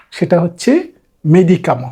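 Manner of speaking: usual speaking voice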